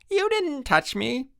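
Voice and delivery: slow, very high indignant whine; scream. A narrator's reading. Jack Tyler disputing Frank's tag, screaming. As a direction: stretch the protest out high. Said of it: "screamed Jack Tyler".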